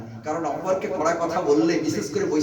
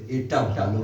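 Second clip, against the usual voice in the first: much lower, tender and gentle; soft tone; 8 semitones lower than usual. Retold or imitated. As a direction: say it softly, like a pleased guru. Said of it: "নরম সুরে বলছে".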